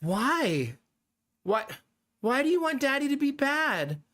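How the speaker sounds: put-on a light child voice, baffled; imitating little son. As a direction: play the confused four-year-old. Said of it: "But then he went".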